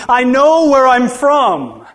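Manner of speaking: voicing Jesus. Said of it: "This is Jesus speaking".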